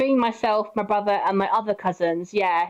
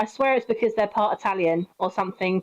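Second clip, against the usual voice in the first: normal talking voice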